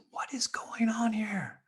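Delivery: slow, puzzled whisper; perplexed whisper. Acted. Baffled villagers whisper among themselves, slow and puzzled. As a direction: whisper it to each other in confusion.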